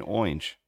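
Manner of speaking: "orange" as a single syllable, "oinj"; imitating dad's "orange". Co-host telling of dad on the phone to a kid, "orange" said as "oinj". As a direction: slip an oy into "orange", dropping the R. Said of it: "He was doing it to my kid".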